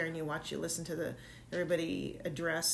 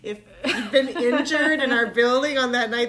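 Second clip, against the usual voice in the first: words through laughter; laughing; 5 semitones higher, 11 dB louder than usual. Real, not acted. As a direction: laugh while asking. Laughing at absurd injury thought.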